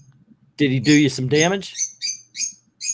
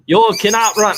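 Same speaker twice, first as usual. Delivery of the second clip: put-on weird European accent; 5 semitones higher, 6 dB louder than usual. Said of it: "some sort of weird European".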